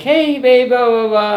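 a light impression of a happy girlfriend; imitating girlfriend. Ex-girlfriend greeting him in the car, mock cheerful. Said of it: "And she's like".

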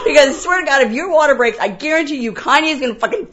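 loud, high comic tirade; exasperated warning. Retold or imitated. Producer warning performer about water breaking, exasperated. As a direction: rant it out in mock desperation.

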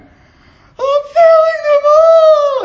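long, loud high-pitched anguished cry; breaking down wail. Retold or imitated. Man breaking down before a church brother, anguished wail. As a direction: wail it out, drawing out every word. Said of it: "I just broke down".

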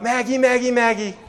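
high calling out across the yard; calling the dog. Retold or imitated. Man calling for his missing dog in the morning, high call.

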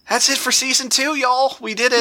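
high, excited season-end cheer. Real, not acted. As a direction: celebrate it, voice lifted high.

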